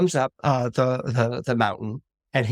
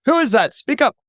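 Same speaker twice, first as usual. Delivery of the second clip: loud, raised demand; imperious shout, pitched up; commanding call; 11 semitones higher, 6 dB louder than usual. Acted. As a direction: call out across the temple. God calls to unseen boy.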